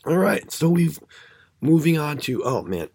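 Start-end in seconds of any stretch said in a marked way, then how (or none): none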